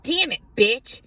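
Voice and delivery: high, loud mock complaint; mock exasperated critic. People cursing her out, a mock exasperated voice. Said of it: "And people are like".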